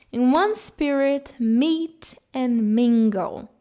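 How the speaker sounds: meet and mingle drawn out slowly, M sounds lingered on; stress the M sounds. Shown as a demonstration. Teacher showing students the repeated M sound, slowly leaning on each M. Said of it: "tá vendo essa repetição do som do M?".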